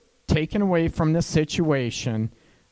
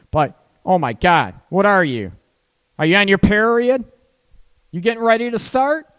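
mocking accusation; 6 semitones higher, 6 dB louder than usual. Mocking guy's go-to line.

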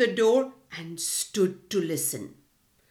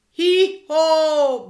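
loud higher hee-haw bray; donkey bray; 6 semitones higher, 9 dB louder than usual. A narrator's reading. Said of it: "brayed the donkey".

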